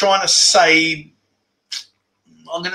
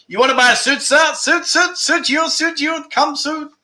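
street hawker; 11 semitones higher than usual. Retold or imitated. Hawker selling a suit.

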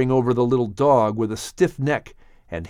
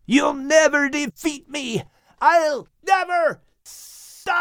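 much higher falling scream of defiance; slower than usual. Acted. Snake screaming defiance as it falls into the abyss, high wailing scream. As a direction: wail it while falling away. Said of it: "heard the snake screaming as he plummeted".

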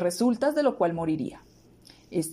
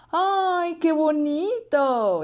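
high, drawn-out delighted gasp; a slow, high, thrilled princess exclamation; cartoon princess; 7 semitones higher, much slower than usual. A narrator's reading. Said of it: "exclamó la princesa".

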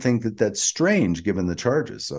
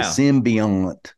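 slow Southern drawl on "symbiont"; Georgia accent. Shown as a demonstration. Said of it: "That's how we'd say it in Georgia".

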